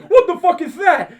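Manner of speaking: high, loud startled cry through laughter; startled yell. Retold or imitated. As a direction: freak out at a strange noise. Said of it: "And all of a sudden he just goes".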